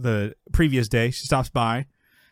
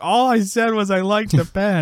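high, raised-voice protest; exasperated mock yell; 9 semitones higher, 4 dB louder than usual. Comedian defends himself amongst all the chaos, mock yell. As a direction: cry it out over the chaos, imitating Jerry. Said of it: "Jerry just yells".

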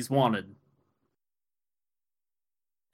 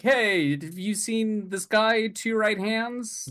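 higher, slow questioning voice; asking around; 8 semitones higher, slower than usual. Retold or imitated. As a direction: call out slowly, searching. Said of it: "just asking everyone, like".

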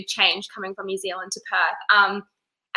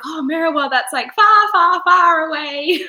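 exaggerated disbelief; 7 semitones higher, 7 dB louder than usual. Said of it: "people are always like".

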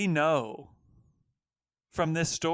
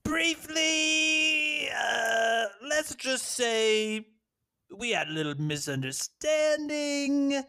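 squeaky evasive; 9 semitones higher, slower than usual. Acted. Dodging an awkward question.

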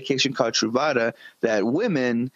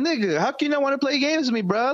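high, pleading whine; high whiny plea; 12 semitones higher than usual. Retold or imitated. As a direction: whine it up high like a hurt friend. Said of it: "I'm like".

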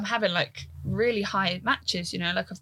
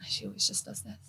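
unvoiced aside; 9 dB quieter than usual. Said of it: "she was like".

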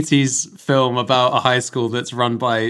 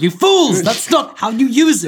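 furious yell at fools; outraged shout; 10 semitones higher, 4 dB louder than usual. Pretend. Imagined virtuoso yelling at amateur.

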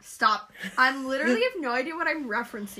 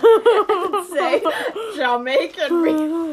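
loud, slow, cracking up; too tickled to get the line out; laughing; 5 semitones higher, 9 dB louder, slower than usual. Real, not acted. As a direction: squeal it through loud laughter. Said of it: "Say it again without laughing".

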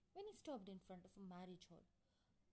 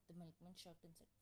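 usual speaking voice

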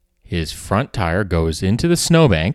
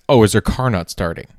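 ordinary talk, nothing marked

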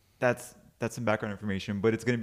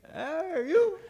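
high catchphrase imitation. Friend recalling the catchphrase's origin, high mimic voice. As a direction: squeal the catchphrase without much volume. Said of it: "That whole shit that he says".

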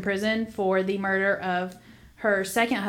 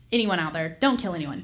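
ordinary delivery

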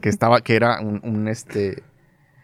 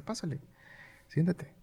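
very soft, higher-pitched aside; hushed beckoning; 8 semitones higher, 14 dB quieter than usual. Retold or imitated. Boss on a phone call ushers employee in, hushed. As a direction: usher someone in under your breath.

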